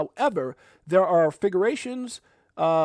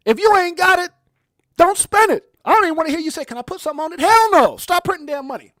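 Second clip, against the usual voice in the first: loud, high furious rant; angry rant; 11 semitones higher, 11 dB louder, faster than usual. Real, not acted. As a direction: tell them off hard. Ranting about government spending.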